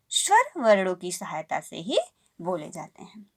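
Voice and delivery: ordinary delivery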